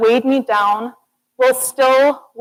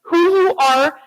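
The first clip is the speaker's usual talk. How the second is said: excited cheer; 7 semitones higher than usual. Real, not acted. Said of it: "celebrate, guys".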